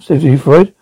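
ordinary delivery

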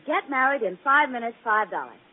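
loud, hammed-up sign announcement; reading a sign aloud. Mary teases Blackie by reading the wedding sign, loud. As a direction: boom it out like a barker. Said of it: "It says".

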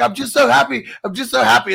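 imitating giddy happiness; high, loud, gushing mock delight; overjoyed. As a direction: gush it at full volume. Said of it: "he's like".

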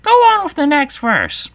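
high, loud, squawky creature voice; hasty Gryphon. Acted. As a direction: squawk it as an impatient order. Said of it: "said the Gryphon hastily".

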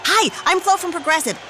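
ordinary delivery

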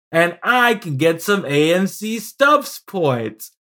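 playful promo voice with drawn-out key words; mock advertisement. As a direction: sell it like a hammed-up commercial. Mock ticket ad after Geico joke.

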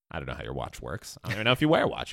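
ordinary talk

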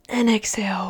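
soft unvoiced breath cue; guided breathing whisper. Shown as a demonstration. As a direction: breathe the word out as you exhale. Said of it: "So I'll do an example".

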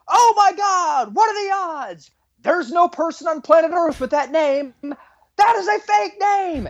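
high, stretched-out mock amazement; shouting out the window. Cop calling the computer result to the driver, mock shouting. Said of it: "shouting out the window".

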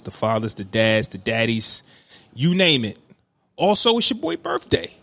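ordinary talk, nothing marked

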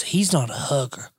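a hint of a father's voice; imitating the dad. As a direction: mimic the dad's excuse, low. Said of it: "the dad looks at me and goes".

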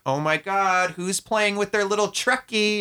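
high, mocking baby talk to a kid. Adults cooing at a child with a toy, mocking high baby talk. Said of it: "people dealing with kids and being like".

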